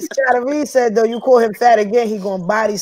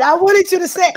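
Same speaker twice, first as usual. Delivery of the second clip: much higher and louder, gleeful; hyped up; 9 semitones higher, 4 dB louder than usual. Real, not acted. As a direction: jump on it like you caught him out. Host hyped by rapper's answer.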